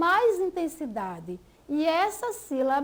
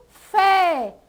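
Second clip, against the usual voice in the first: fé said loud and forcefully; stressed syllable; 3 semitones higher, 6 dB louder than usual. Shown as a demonstration. Teacher answering which syllable is strongest, emphatic loud fé. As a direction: punch out fé on its own. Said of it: "a sílaba mais forte dessa palavra".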